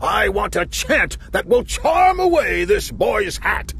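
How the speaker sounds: high, slow royal shout; king shouting. Acted. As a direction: bellow the demand from the throne. King demands a charm.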